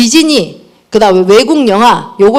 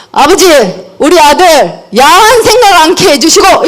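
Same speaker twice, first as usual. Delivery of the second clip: mock earnest prayer; 6 semitones higher, 4 dB louder than usual. Mother praying aloud over son.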